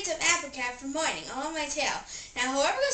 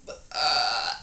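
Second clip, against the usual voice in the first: breathy burp; 10 semitones lower, 3 dB louder than usual. Acted. Burping contest, burps.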